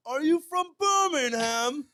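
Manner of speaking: high, loud, slow mock British drawl; imitating a drunk British question. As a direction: ham up the drunk Brit's question. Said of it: "in the great words of Noah Perrin".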